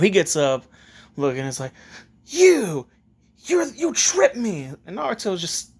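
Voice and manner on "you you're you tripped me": high, loud, drawn-out accusing cry; indignant accusation. Acted. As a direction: play an outraged kid pointing blame. Kid accuses Naruto after falling.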